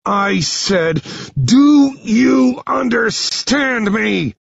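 slow, high, loud demand with each word stretched; enraged drawn-out yell; furious shouted demand. Acted. Hero demands answer while squeezing.

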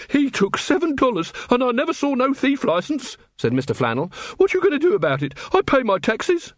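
high-pitched indignant complainer; aggrieved shopkeeper. A narrator's reading. Robbed man complains to the Watch, aggrieved. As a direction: complain in a high, put-upon voice.

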